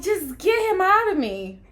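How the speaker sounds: loud, high, strained yell; retold scream. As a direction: imitate yourself screaming in labor. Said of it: "And I'm screaming, and I'm like".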